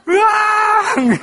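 high drawn-out mock wail; imitated wailing cry. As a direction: howl it out slowly like a sobbing child. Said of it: "대성통곡을 하면서". (아이 울음 흉내, 길게 높이 으앙)

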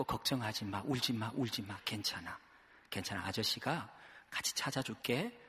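soft, low, gentle reassurance; comforting a child. Retold or imitated. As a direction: hush a crying kid kindly. Pastor comforting lost child. (우는 아이를 달래는 부드럽고 낮은 목소리)

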